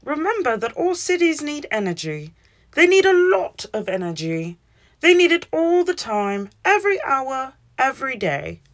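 teacher hammering home a point; emphatic teacher. A narrator's reading. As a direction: drive home the lesson to the class. Teacher stresses cities need energy.

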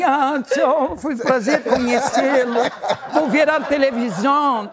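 very high, sing-song Portunhol goodbye; hammed-up polite farewell; mock-sweet farewell. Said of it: "ela falou assim".

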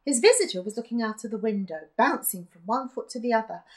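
ordinary delivery

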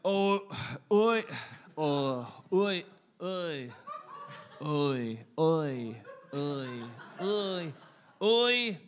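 testing broad Australian "oi" repeatedly; Australian accent warm-up. Shown as a demonstration. Comic warming up for an Australia show, practising an Australian accent. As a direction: work up to an Australian "oi, like". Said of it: "phrase that I used to warm up".

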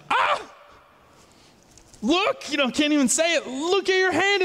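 high, startled cry of disbelief; startled shout. Retold or imitated. Leper shocked to see his friend's hand. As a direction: gasp out the words in shock.

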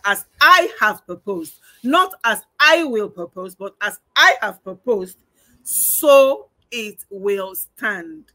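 loud, weighty scripture quote; emphatic divine declaration. Acted. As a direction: declare it forcefully, stretching out the closing words. Quoting God's emphatic declaration.

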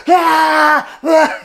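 sudden high yelp of fright; imitated scream. Reenacting a guest's fright at the haunted keyboard, startled shriek. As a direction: jolt out a shriek like she leapt off the bed. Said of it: "jumped up out of her skin and goes".

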